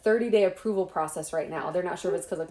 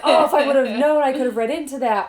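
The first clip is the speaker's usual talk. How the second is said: higher, louder, laughing hindsight exclamation; mock realization; 5 semitones higher, 6 dB louder than usual. Author imitating a reader spotting a missed clue, laughing mock surprise. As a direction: play the reader kicking themselves, half laughing.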